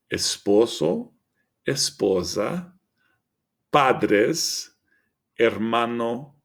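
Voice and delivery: each word read slowly with a rising end; slow teaching read-out. Shown as a demonstration. Teacher reading vocabulary to students, slow and clear. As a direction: clear model pronunciation for students. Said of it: "Reading through".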